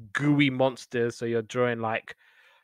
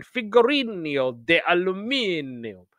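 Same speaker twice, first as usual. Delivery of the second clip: put-on Italian accent; 5 semitones higher than usual. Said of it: "in an Italian accent".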